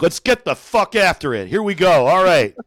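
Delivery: loud, hyped anchor ranting at the camera; news anchor turned hype man, all energy; Wolf Blitzer pumped up. Retold or imitated. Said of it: "If Wolf Blitzer was like".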